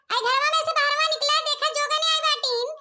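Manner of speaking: ordinary delivery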